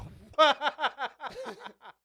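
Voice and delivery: real high, loud belly laugh; high-pitched, uncontained cackle; loud burst of laughter. Real, not acted. Listener bursts out laughing at joke.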